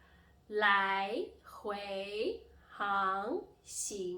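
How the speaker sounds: 来回航行 very slow, every syllable swooping upward; second tone. Shown as a demonstration. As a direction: stretch each syllable of 来回航行 and lift its end. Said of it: "mimic like a plane cruising".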